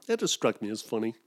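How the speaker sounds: ordinary talk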